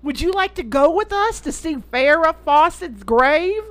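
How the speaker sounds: hammed-up imitation of a Southern lady; bad southern accent. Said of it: "bad southern accent".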